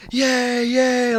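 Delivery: high, drawn-out mock cheer; high little hooray; imitated cheering crowd. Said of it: "so all these people are like".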